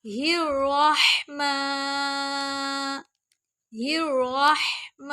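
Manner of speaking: ہی الرحمن drawn out slowly; teacher repeating ہی الرحمن at half pace; slow spelled Rahman. Shown as a demonstration. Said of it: "ہم میم کو لمبا کریں گے". (آہستہ ہی الرحمن)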